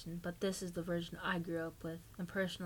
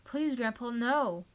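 higher, louder terrified cry; girl begging grandpa holding a knife; terrified scream; 4 semitones higher, 5 dB louder, slower than usual. A narrator's reading. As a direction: scream for your life at grandpa. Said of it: "She screamed in terror".

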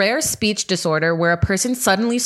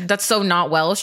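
usual speaking voice

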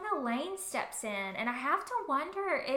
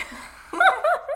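a much higher, louder laugh; burst of laughter; 13 dB louder than usual. Real, not acted. Fan laughing at her own nerdiness. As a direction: break into giddy laughter. Embarrassed over the coloring book.

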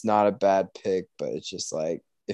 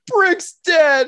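much higher, louder, blubbering wail; mock crying Brick; 8 dB louder than usual. Retelling Anchorman to his friend, imitating Brick's tearful wail. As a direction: ham up Brick sobbing at his own funeral. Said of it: "he's, like, in tears, like, crying".

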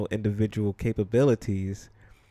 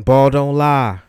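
catchphrase shout; 4 semitones higher, 12 dB louder, slower than usual. Acted. Fan shouting a famous basketball catchphrase, loud and proud. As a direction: belt out the catchphrase, higher and much louder.

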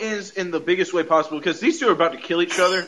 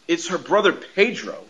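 normal talking voice

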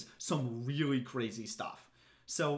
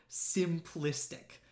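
"simplistic" said very slowly and drawn out; quoting the author; much slower than usual. Retold or imitated. Reading the author's dismissive word aloud, drawn out slowly. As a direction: stretch out "simplistic" like a scare quote.

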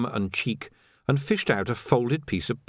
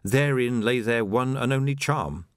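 ordinary delivery, nothing marked

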